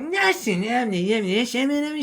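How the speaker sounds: very high, loud garbled babble; mock high-pitched gibberish outburst; imitated street stranger. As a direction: mimic a stranger's unintelligible yelling. Mimics stranger's garbled repeat.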